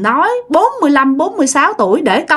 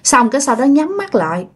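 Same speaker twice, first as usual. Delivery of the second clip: ordinary talk, nothing marked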